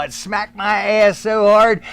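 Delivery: usual speaking voice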